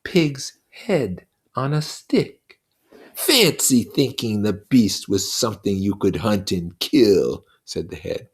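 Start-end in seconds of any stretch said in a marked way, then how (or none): 0.0-2.6 slow, higher, forced words; Simon, straining. Acted. Simon forcing out words at the head, strained. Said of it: "brought forth audible words". 3.1-7.5 slow, higher, louder sneering demon voice; a buzzing devil taunting a child; Lord of the Flies, mocking. Lord of the Flies taunting Simon, slow and sneering.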